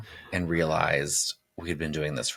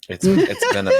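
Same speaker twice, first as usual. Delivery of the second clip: laughing; 14 semitones higher, 8 dB louder than usual. Real, not acted.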